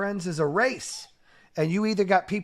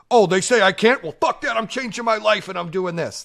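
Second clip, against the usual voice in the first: loud, higher, heated mock defiance; defiant outburst; 6 semitones higher, 9 dB louder than usual. Host mimicking a reactive defiant rant, loud and heated. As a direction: blow up at the doubters, half-acted. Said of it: "I don't go".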